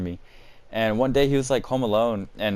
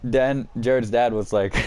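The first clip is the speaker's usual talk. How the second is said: usual speaking voice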